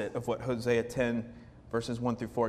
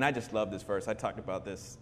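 usual speaking voice

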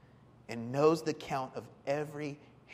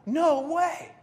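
startled; 11 semitones higher, 7 dB louder than usual. Retold or imitated. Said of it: "he's like".

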